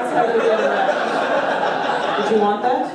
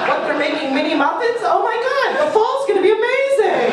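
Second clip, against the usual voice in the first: much higher, squealing with fake delight; mock gushing excitement; 10 semitones higher than usual. Comic mocking New Yorkers thrilled by muffins, squealing mock delight. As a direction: gush like a thrilled shopper.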